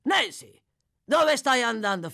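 loud, high challenge; commanding, suspicious call. Acted. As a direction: stop her with a demand. Sykes calls after departing Nancy.

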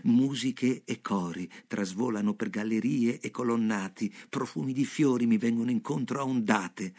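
ordinary delivery, nothing marked

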